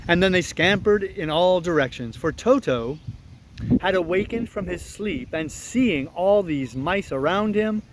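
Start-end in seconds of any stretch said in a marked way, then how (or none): none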